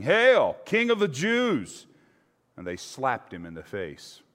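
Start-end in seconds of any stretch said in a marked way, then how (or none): 0.0-1.9 high, loud, drawn-out mocking salute; mocking hail. A narrator's reading. Soldiers mocking Jesus with a loud, high jeer. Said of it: "went up to him again and again, saying".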